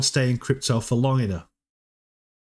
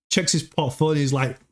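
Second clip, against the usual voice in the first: ordinary talk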